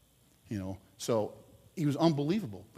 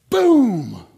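shouted "Boom!" much louder and higher; 7 semitones higher, 15 dB louder than usual. Retold or imitated. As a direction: blast out the bat crack. Home run hit in story.

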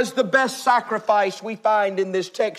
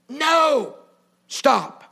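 angry telegram; much slower than usual. Acted. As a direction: bark the refusal slowly, word by word. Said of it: "Almost in anger".

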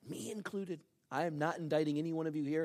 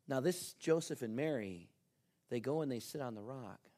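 ordinary talk, nothing marked

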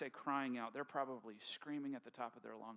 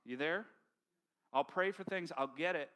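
normal talking voice

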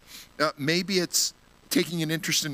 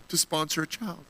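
normal talking voice

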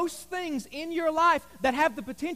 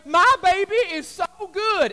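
higher, louder gushing; proud mom; 6 semitones higher, 6 dB louder than usual. Retold or imitated. Mom boasting to the camera about her baby. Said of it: "the mom looks at the camera".

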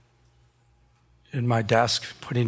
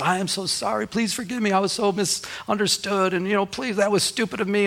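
apologetic pastor; 8 semitones higher than usual. Retold or imitated. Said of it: "He said".

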